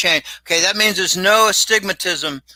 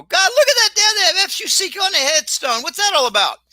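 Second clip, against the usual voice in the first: high, excited retold exclamation; amazed teenager; 12 semitones higher than usual. Teenage son asking his dad about a headstone, high excited mock amazement. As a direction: sound like a wide-eyed kid pointing something out. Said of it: "I said to my dad".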